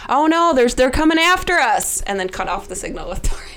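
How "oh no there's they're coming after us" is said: loud alarmed shout, higher pitch; panicked yelling. Acted. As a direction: yell it in a panic to cause a scene. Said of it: "start yelling".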